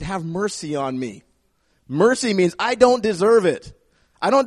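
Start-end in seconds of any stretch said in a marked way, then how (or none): 0.0-1.2 humble plea. Acted. Contrast: David's real humble prayer.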